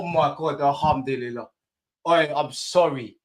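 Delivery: ordinary talk, nothing marked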